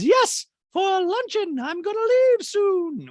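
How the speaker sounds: high, loud, drawn-out society-lady caricature; Dorit. Acted. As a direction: trumpet it slowly in falsetto. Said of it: "And Dorit goes".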